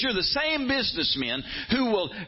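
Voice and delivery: high, building to a shout; preaching at full force. Retold or imitated. Preacher voicing Jesus against widow-robbers, rising to a shout. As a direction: thunder at the greedy businessmen. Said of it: "And he says".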